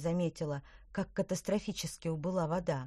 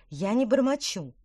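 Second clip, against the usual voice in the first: snaps back, louder; sharp retort; 5 semitones higher, 7 dB louder than usual. Acted. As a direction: cut him off sharply. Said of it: "одёрнула его Петронелла".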